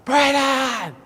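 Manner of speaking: long, high drawn-out holler. Retold or imitated. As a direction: holler the name, stretched out and high. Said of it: "Now I get louder".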